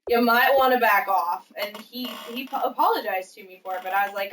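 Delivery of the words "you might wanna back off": mother-in-law's threat. Retold or imitated. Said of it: "She's like".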